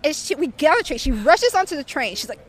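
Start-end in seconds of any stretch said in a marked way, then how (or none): none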